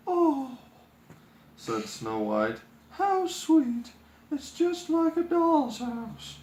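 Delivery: put-on high, delighted young princess; sweet princess voice. A narrator's reading. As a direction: coo it like a charmed girl.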